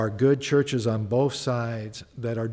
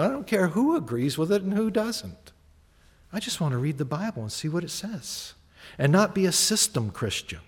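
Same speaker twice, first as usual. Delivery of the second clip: ordinary talk, nothing marked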